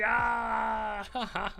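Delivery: high drawn-out "ah"; high-pitched mock scream; mock yell. Voicing reaction in retold skit.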